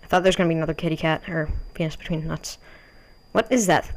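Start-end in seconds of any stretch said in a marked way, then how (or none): none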